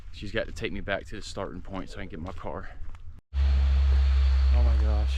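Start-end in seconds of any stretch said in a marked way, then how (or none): 3.4-5.2 slow, louder, awed oh my gosh; real awe at the end of the trail; overwhelmed. Real, not acted. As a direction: drag it out in awe at the finish. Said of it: "I'm about to get emotional".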